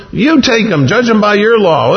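high-pitched, irritated brush-off; exasperated Pilate. Acted. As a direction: push the problem back at them. Acted quarrel, Pilate dismissing accusers.

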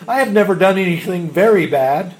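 loud, high-pitched protest; affronted, emphatic denial; indignant. A narrator's reading. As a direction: defend yourself as if wrongly accused. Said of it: "she asked indignantly".

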